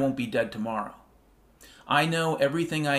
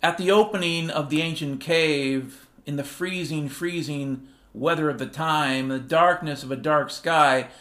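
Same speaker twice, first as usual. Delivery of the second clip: mock epic storytelling; 2 semitones higher, 3 dB louder than usual. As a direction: tell it like a legendary saga, half chanted. Sung-spoken mock epic story.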